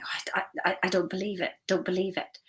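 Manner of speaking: disbelieving hush. Retold or imitated. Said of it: "And they're like".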